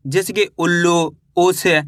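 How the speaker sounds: ordinary talk, nothing marked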